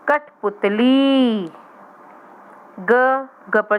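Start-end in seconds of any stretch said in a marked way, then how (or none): 0.1-1.5 Kathputli stretched very slowly, each syllable long; slow sounding out. Shown as a demonstration. Teacher to young pupils, saying Kathputli slowly. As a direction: draw out Kathputli for beginners. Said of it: "teacher sounds out word".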